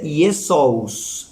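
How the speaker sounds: Jesús said slowly as "ee-eh-soos"; Jesús as the Greek Iesous; Greek pronunciation. Shown as a demonstration. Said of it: "en griego se dice".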